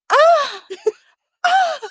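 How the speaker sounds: two high mock cries; imitated high-pitched yelps with laughter; imitated noise. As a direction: cry out twice, high and hammed-up. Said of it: "And then I hear".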